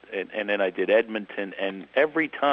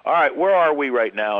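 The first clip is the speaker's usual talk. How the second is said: loud question; 5 semitones higher, 7 dB louder than usual. Retold or imitated. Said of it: "I said".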